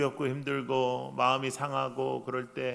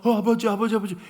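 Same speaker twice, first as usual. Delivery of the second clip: excited son; 5 semitones higher, 7 dB louder than usual. Retold or imitated. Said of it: "첫째가 뭐".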